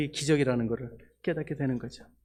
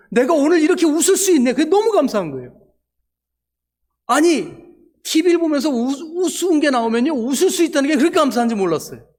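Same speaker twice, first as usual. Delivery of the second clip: high, loud, overjoyed cry of thanks; ecstatic gratitude; 10 semitones higher, 8 dB louder than usual. Real, not acted. Preacher to congregation, thrilled he can laugh again, overjoyed shout. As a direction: burst out with joy at being able to laugh.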